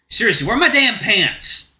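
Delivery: ordinary talk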